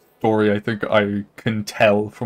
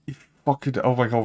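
ordinary delivery

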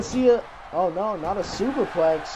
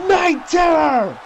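slow, loud, higher shout of the move; shouted finisher name; 6 semitones higher, 4 dB louder, slower than usual. Acted. Commentator hyping the finishing move, slow loud shout. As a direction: bellow the move's name to the arena. Crowd-pleasing finisher call.

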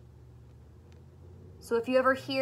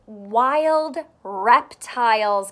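slow and loud, each word spaced; slow, clear title read; 7 dB louder, much slower than usual. Shown as a demonstration. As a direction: read the title out slowly and loudly for the kids. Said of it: "Can you say that?".

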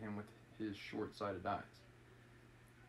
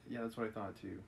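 usual speaking voice

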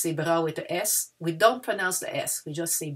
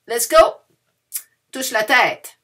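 big, high call to play; Simon-says call to the kids; loud game call; 6 semitones higher, 11 dB louder, slower than usual. Acted. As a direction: lead the game with energy. Game leader calls commands.

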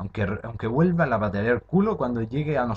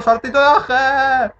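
much higher, louder, slow sing-song cry; triumphant call; 11 dB louder, slower than usual. Real, not acted. Gamer calling out her big jump, shouting in triumph. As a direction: announce the move like a victory shout.

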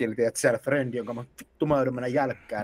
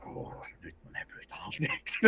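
sheepish whisper; 13 dB quieter than usual. Retold or imitated. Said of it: "mä olin silleen".

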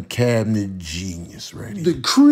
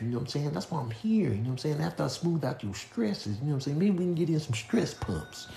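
sweet-talking a tree softly; smooth seducer; 11 dB quieter than usual. Pretend. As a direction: croon softly like a smooth lover. Crooning smooth talker to tree.

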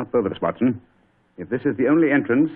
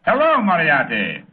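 drawn-out, higher and louder ironic hello; slow mocking greeting; 7 semitones higher, 4 dB louder, slower than usual. Holmes greeting his trapped-him enemy with slow irony. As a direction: greet the villain with cool sarcasm.

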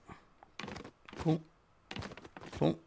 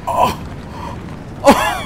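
surprised shout; 9 semitones higher, 4 dB louder than usual. Real, not acted. Startled by sudden event.

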